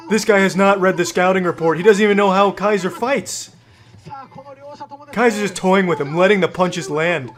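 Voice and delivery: ordinary delivery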